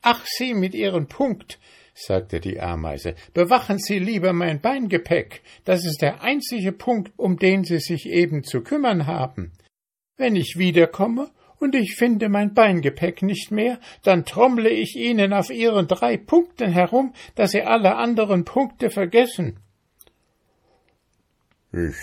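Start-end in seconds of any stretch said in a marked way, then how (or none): 0.0-1.6 high, exasperated ant voice; irritated ant. A narrator's reading. Ant fed up with beetle, irritated. 3.3-19.6 very high, threatening ant tirade; scolding ant. A narrator's reading. Ant threatening beetle over luggage, scolding.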